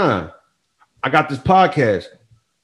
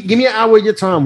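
higher, begging tone; high pleading voice; 10 semitones higher than usual. Retold or imitated. Pleading to the friend.

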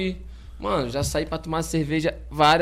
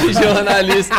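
laughing; 4 semitones higher, 9 dB louder, slower than usual. Real, not acted. Joking about journalist clients.

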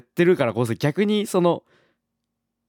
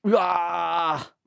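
excited; 4 semitones higher, much slower than usual. Real, not acted. Hyped by the news.

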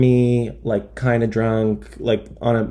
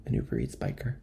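"An Uber Eats biker" much lower and softer, half to himself; stunned repeat; 13 semitones lower, 14 dB quieter than usual. Real, not acted. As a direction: mutter "Uber Eats biker" in disbelief, voice dropped. Disbelieving repeat of the punchline.

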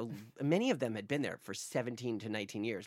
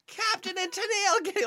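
very high excited exclamation; excited high squeal; 4 dB louder than usual. Retold or imitated. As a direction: squeal it out in shock. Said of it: "I'm like".

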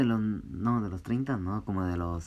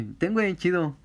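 high mock voice; 9 semitones higher than usual. Acting the friend handing it back.

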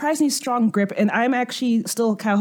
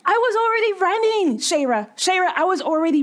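indignant; 8 semitones higher than usual. Acted. Indignant character protesting.

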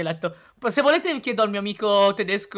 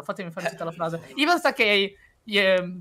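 normal talking voice

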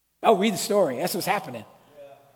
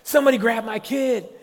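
high, loud panicked outburst; freaking out; 7 semitones higher, 8 dB louder than usual. Acted. Parent who just lost a child in the store, acted panic. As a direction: cry out in mounting alarm. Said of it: "you start freaking out".

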